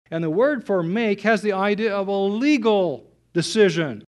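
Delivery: ordinary talk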